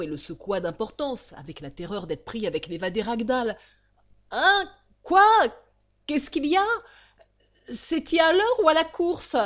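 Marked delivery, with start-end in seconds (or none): 4.3-9.4 loud, much higher rough coachman startled awake; drowsy cabman shaken awake, shouting; groggy coachman woken. Acted. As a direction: bark out confused questions, half asleep.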